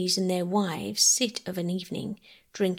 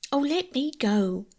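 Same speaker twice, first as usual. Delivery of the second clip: mild cry; 6 semitones higher, 3 dB louder than usual. A narrator's reading. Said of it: "cried Bobby".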